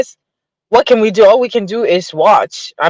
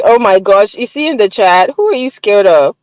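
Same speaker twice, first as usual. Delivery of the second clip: ordinary talk, nothing marked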